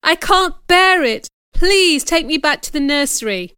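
high, pleading outburst; desperate cry. A narrator's reading. Child begs aunt to free her from the room, desperate. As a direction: implore loudly, pitched well up. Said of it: "I cried desperately".

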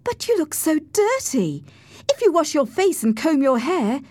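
put-on high, girlish young lady's voice; coaxing girl. A narrator's reading. Cathy urges Heathcliff to wash, high girlish voice.

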